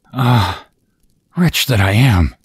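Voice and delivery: mildly dramatic, rueful sigh; sighing lament. A narrator's reading. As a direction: lament it like a storybook princess. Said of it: "sighed she".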